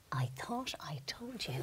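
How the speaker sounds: hushed whispered jab. Retold or imitated. Sister to passenger at LAX, hissed whisper. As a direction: whisper it sharply under the breath. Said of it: "and she said".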